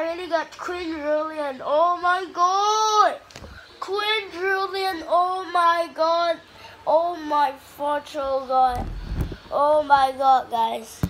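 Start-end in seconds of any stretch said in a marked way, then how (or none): 1.6-6.4 slow, high, loud awestruck exclaims; drawn-out delight. Real, not acted. Gamer savouring his fortune, slow awed exclaims. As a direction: savour the win, stretching every word. Celebrating quadrillion.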